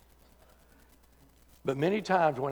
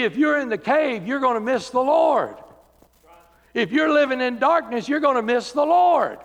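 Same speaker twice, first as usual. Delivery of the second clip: loud, high, driving sermon climax; full-force preaching; 7 semitones higher, 7 dB louder than usual. Acted. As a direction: preach it out hard to the back pews.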